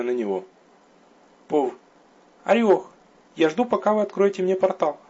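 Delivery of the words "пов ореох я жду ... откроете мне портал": puzzled, expecting him to act; surprised. A narrator's reading. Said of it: "удивлённо откликнулась".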